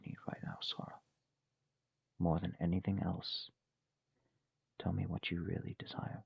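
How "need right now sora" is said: soft fast whisper. Acted. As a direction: breathe the question out. Gentle coaxing question to friend.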